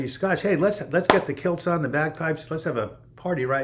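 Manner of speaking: party-starting cheer for the Scottish chat; excited cheer. Real, not acted. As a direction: whoop it up for the Scots.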